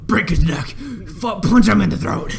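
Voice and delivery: loud, high, hammed-up bellow; Khonshu's violent shouting. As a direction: burst out shouting like a bloodthirsty god. Imitates Khonshu urging violence.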